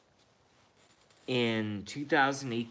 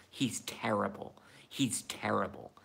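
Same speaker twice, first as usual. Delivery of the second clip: ordinary talk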